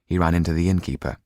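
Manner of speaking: ordinary delivery, nothing marked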